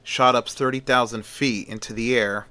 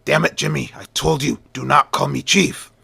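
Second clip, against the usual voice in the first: raised, higher angry voice; angry scream; 4 semitones higher, 4 dB louder than usual. Acted. Editor rebuking Jimmy for calling him Chief, angry yell. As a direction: boss yelling a reprimand, each word pushed.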